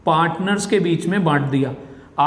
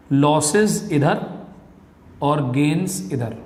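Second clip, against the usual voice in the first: slow and deliberate; 3 semitones lower, slower than usual. Shown as a demonstration. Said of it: "teacher pointing out debit and credit sides".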